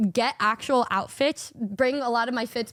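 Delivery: normal talking voice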